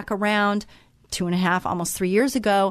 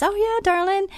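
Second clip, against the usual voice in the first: sweet lady's voice; 12 semitones higher than usual. Retold or imitated. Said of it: "And she says".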